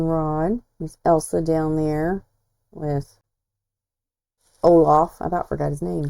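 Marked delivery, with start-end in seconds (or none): 2.7-5.2 long pause before Olaf; dramatic pause. Real, not acted. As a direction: hang on with, then land Olaf. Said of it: "a long dramatic pause for effect".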